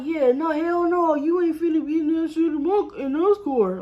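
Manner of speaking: retold boyfriend putting his foot down; angry boyfriend. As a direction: shut it down hard. Boyfriend refusing again.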